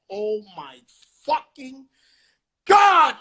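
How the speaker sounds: from normal up to a high, loud cry on God; shocked exclamation. Retold or imitated. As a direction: let the disbelief build until it bursts. Reacting in shock, building.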